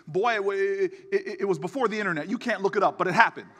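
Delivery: father defending his tall tale to his son, indignant; indignant dad. Retold or imitated. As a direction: huff like a dad caught stretching the truth. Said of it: "he gets all upset".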